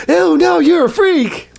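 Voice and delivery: very high squeal of disgust; mock disgusted snob. Mocking hypocrites refusing the freak, high squeal of disgust. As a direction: shriek it like a prissy snob.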